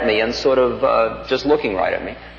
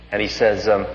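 ordinary delivery

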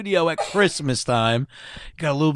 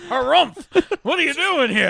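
grumpy voice; 10 semitones higher than usual. Pretend. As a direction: play a cranky grouch, laughing. Acting grumpy at the carolers.

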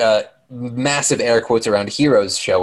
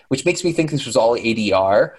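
normal talking voice

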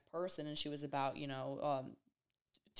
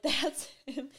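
laughing; 8 semitones higher, 4 dB louder than usual. Retold or imitated. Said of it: "I was like".

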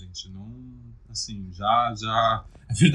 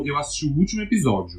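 ordinary delivery

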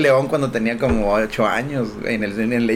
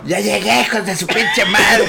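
higher, drawn-out hollered arrival; mock drunken yell; 6 semitones higher, slower than usual. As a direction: announce yourself to the whole street, laughing. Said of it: "Gritaba muchas mamás". (grito de borracho imitado)

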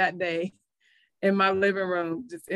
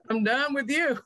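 higher, half-laughing retold outburst; laughing defiance; 7 semitones higher than usual. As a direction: laugh through a mock-defiant line. Retells defying God, laughing.

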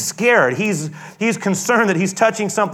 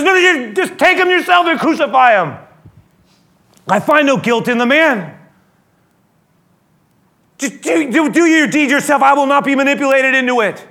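loud, high, exasperated refusal; frustrated; 8 semitones higher, 5 dB louder than usual. Retold or imitated. Pilate refuses the crowd, loud and frustrated. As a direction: throw it back at them in frustration. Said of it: "Pilate is clearly frustrated".

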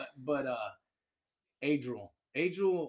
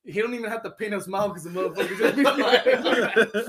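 laughing while talking; 7 semitones higher, 5 dB louder than usual. Real, not acted. Laughing at friend's smile.

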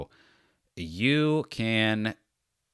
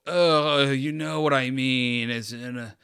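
annoyed listener; 3 semitones higher than usual. Retold or imitated. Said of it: "And people go".

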